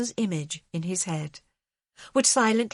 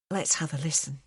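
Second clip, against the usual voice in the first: put-on soft Scots accent; 4 semitones lower than usual. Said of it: "The soft Scots accent".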